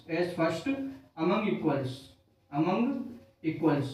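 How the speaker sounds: feed it to them piece by piece, quietly; dictating word by word. Shown as a demonstration. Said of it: "teacher repeats phrase in pieces".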